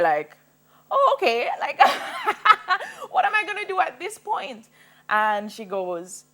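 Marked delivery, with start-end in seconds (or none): 0.9-4.7 much higher, loud, with a laugh; a stunned laugh at impossible news; incredulous high laughing reply; laughing disbelief. Retold or imitated. Retelling her reaction to bad news.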